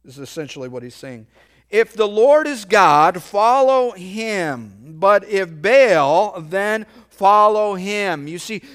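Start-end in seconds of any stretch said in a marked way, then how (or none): 1.7-8.3 forceful challenge. Acted. Elijah's challenge to the nation.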